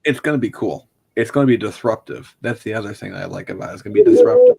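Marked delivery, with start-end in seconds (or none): 3.9-4.6 high, loud, theatrical repeat; dramatic shout. Acted. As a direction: belt it out like a big announcement.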